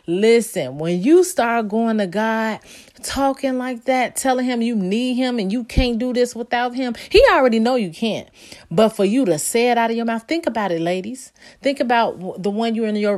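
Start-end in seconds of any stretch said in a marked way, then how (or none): none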